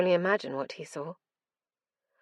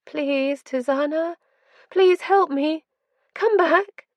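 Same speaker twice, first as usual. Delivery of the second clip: high, loud, desperate begging; desperate pleas; 10 semitones higher, 6 dB louder, slower than usual. A narrator's reading. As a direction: beg her to come back. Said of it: "her pleas".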